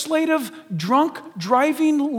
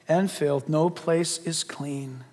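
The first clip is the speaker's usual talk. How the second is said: grave scripture; 5 semitones lower, slower than usual. Retold or imitated. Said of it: "he says".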